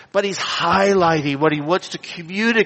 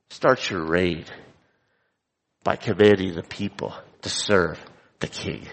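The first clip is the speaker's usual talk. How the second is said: disapproving echo; 9 semitones lower, 3 dB quieter than usual. Shown as a demonstration. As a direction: lower and quieter, stress on "Start" and "commanding".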